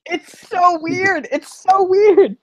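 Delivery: high, drawn-out exclamation; high-pitched, slow incredulity; exasperated disbelief. Real, not acted. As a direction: stretch it out high, really weirded out. Reacting to uncomfortable scene ending.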